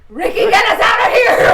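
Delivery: high, very loud panicked yell; urgent shout. Acted. Player yells to Ricky for escape, urgent shout. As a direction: holler up to the pilot for rescue.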